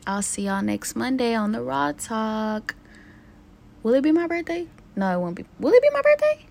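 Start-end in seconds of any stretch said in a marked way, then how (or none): 3.8-4.7 higher, playful question; teasing question. Pretend. Teasing herself about birthday. 5.6-6.4 very high, louder squeal of a question. Pretend. Teasing herself about birthday.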